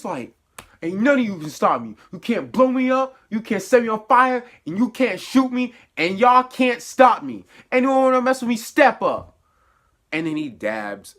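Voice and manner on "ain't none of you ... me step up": higher, louder defiant challenge to everyone; battle cry. Retold or imitated. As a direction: call out the whole crowd like a fighter. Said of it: "like, a battle cry".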